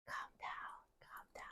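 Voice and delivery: hushed whisper to settle the dog; barely voiced, soothing him down; whispered calm down. Real, not acted. Groomer whispering to her nervous dog, hushed.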